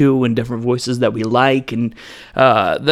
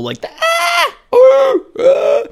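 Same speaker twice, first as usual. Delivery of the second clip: very high, loud, mock screams; demo scream; 5 dB louder, much slower than usual. Shown as a demonstration. Explaining how screams grab the ear, with a hammed-up shriek. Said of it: "the human scream hits a certain decibel".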